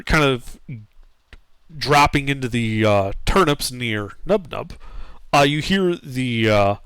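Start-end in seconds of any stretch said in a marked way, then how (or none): none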